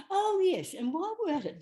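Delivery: high, loud mock interviewer; a light mock of a surprised host; Parkinson's cue. Parkinson being led into the question, mock high. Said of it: "And he went".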